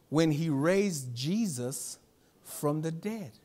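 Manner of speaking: very slow, deliberate reading; slow scripture reading. Acted. Preacher reading scripture aloud to the church, very slow. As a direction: dwell on each phrase of the verse.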